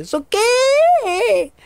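high, loud surprised yelp; a sudden startled shout of disbelief; shocked what. Real, not acted. Reacciona sorprendido en el juego. (grito de sorpresa, agudo y fuerte)

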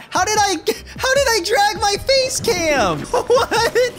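very high disbelief tipping into laughter; baffled high squeal. Real, not acted. Streamer baffled at dragging the face cam, high laughing squeal. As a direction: squeal the question, then laugh.

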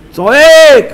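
high, drawn-out shout. Shown as a demonstration. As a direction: cry the word out high. Preacher shouts the word shouting. (צעקה רמה)

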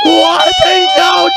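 high, loud yelp of shock; husband shrieks in shock at the surprise; startled shriek. Acted. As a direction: yelp as if jump-scared.

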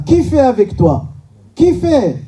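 ordinary delivery

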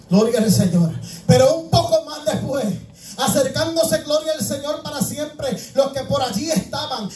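normal talking voice

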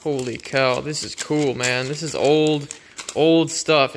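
ordinary talk

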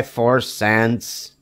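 ordinary delivery, nothing marked

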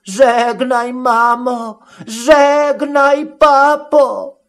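acted tearful lament, sustained high; moaning and weeping. A narrator's reading. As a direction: wail goodbye in a high put-on voice. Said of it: "Jęczy tylko i wciąż płacze".

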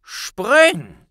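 high, loud order; commanding shout. A narrator's reading. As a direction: bark it as a command. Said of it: "befahl Dumbledore".

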